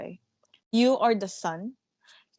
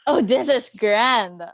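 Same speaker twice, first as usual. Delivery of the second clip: sarcastic; 2 semitones higher, 8 dB louder, slower than usual. Acted. Manager scoffs at actors' idea.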